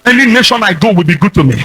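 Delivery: ordinary delivery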